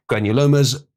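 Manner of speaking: normal talking voice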